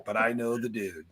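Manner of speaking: lightly voiced inmate bragging he knows the guy; mock prison boast. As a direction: toss it off like a con claiming he knows everyone. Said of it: "Anytime someone says in prison".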